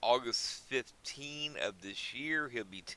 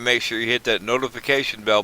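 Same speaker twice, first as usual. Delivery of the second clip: ordinary delivery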